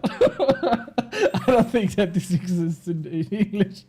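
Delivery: loud laughter breaking into the words; slow, laugh-broken speech. Real, not acted. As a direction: crack up at your own phrase, dragging it out. Amused at own clumsy translation.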